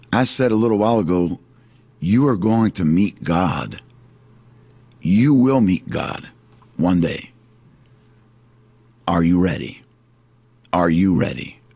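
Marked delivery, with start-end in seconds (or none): none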